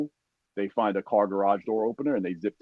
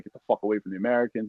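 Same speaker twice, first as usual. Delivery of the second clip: normal talking voice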